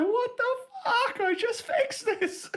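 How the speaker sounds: furious little robot. Retold or imitated. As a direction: squeak it out like a fed-up tiny robot. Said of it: "He's just like".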